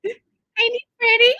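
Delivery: high, loving cat talk; pet talk. Real, not acted. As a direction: coo proudly over the kitty. Cooing over the cat photo.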